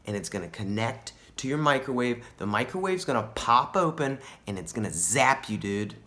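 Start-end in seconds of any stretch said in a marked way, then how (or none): none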